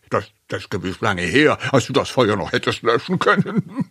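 quick jibe tipping into a laugh; teasing laughter. A narrator's reading. Uncle Steve teases the other uncle, laughing. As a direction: hurry the taunt and end laughing.

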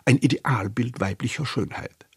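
ordinary delivery, nothing marked

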